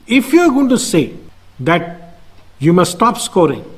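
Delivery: normal talking voice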